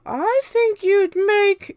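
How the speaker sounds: very high, loud, slow sneer; a spiteful witch setting up the insult; Aunt Spiker. A narrator's reading. Aunt Spiker mocking her sister, high and loud. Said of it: "Aunt Spiker said".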